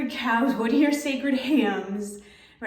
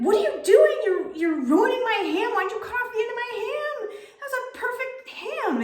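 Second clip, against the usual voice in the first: upset husband; 12 semitones higher than usual. Retold or imitated. Husband upset over ruined ham.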